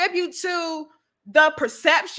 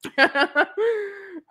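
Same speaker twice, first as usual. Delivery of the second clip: high laugh; real amused laugh; teasing chuckle; 6 semitones higher than usual. Real, not acted. Laughing after teasing Anthony.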